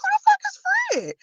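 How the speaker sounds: high, incredulous question; mock disbelief. Vlogger asking her mother about Fred, high mock squeal. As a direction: ask it in baffled disbelief.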